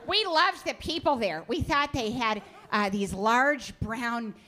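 mom voice. Retold or imitated. Mom voice, clueless tourist rant.

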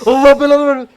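very high, very loud scream of curse; furious scream. Real, not acted. Scared by the game.

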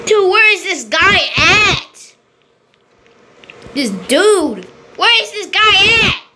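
high, exasperated yell; frustrated rage. Real, not acted. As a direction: demand it, pitch swooping up in frustration. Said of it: "Why do I rage so much".

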